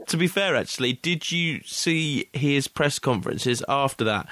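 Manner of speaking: ordinary talk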